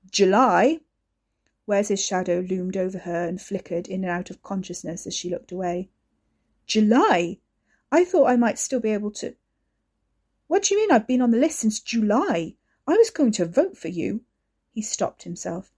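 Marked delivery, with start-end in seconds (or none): 0.1-0.8 loud stunned question; shocked. Acted. Wes shocked at news. 6.7-14.3 louder, higher hurt anger; stung brother pushing back; upset protest. Acted. Wes upset, interrupted protest.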